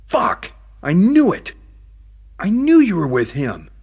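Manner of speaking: a high, loud, furious shout; angry outburst. Acted. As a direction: explode at the news. Outburst at hearing it's Bo's.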